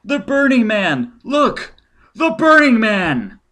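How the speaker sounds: high, loud alarmed cry, drawn out; crowd shouting. Acted. Onlookers crying out at the burning figure, loud and high. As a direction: shout it out to the whole square.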